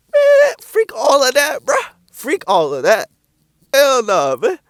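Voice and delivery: a loud, high, dragged-out dismissal; scornful outburst. Real, not acted. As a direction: throw the whole idea away loudly. Dismissing the doctors' pitch.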